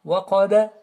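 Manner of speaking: heavy qa, echoing release ending "Waqaba"; qalqala. Shown as a demonstration. Teacher shows vibration, bounced "Waqaba". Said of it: "une sorte de vibration".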